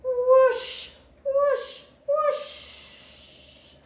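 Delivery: high, loud "whoosh" with long hiss. A narrator's reading. Tortoise suddenly sledging down the hill, whooshing. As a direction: swoop up high, trailing a breathy hiss.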